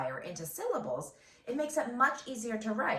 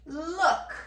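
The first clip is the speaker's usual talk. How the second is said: playful high look; 9 semitones higher, 4 dB louder than usual. Acted. Playful repeat of the word.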